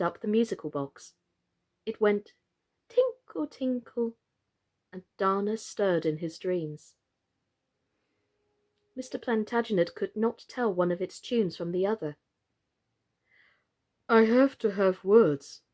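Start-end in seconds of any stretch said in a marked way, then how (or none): 2.9-4.2 high, light tinkling of a music box. A narrator's reading. Narrator mimics the musical box, high and tinkly. 14.1-15.6 put-on a slow, louder old gentleman doll; lightly voiced old doll. A narrator's reading. Mister Plantagenet to Totty, slow and earnest. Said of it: "said Mister Plantagenet".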